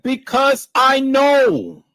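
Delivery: high, loud, slow imitation yell; mock shouted confession. Mocking Teresa's answer in a high slow yell. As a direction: bellow it slowly in a put-on voice. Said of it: "She said".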